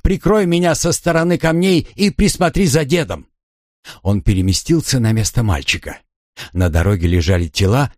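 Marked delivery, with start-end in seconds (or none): 0.0-3.3 higher urgent shouted order; cover me shout. A narrator's reading. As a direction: yell the order over gunfire. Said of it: "крикнул он".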